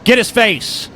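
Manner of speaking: yelling at the screen. Real, not acted. Urging on a fight on screen.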